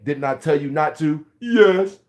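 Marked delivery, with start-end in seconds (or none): 1.4-2.0 put-on high, loud kid reply; child's answer. Scolded child answering his parent, high and loud.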